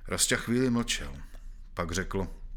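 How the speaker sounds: usual speaking voice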